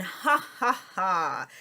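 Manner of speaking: big, spelled-out ha-ha-ha; hammed-up laugh from a poem; acted laughter. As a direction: laugh it out in separate, open syllables. Poem's laugh out the window.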